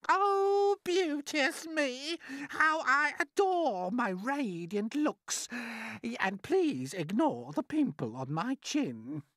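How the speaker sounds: high, slow and theatrical self-admiration; vain, preening aunt. Acted. Admiring her own radiant looks in rhyme, high and preening. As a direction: draw out each admiring word in a high voice. Vain character reciting verse.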